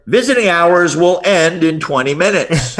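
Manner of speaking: slow announcement, as over a hospital speaker; loudspeaker announcement. Retold or imitated. Hospital speaker interrupting his teleseminar, slow announcement. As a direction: play the PA voice booming from the wall.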